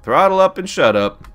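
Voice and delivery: loud, high-pitched order to yourself; high, loud playful command; mock self-command. Repeats own command to himself.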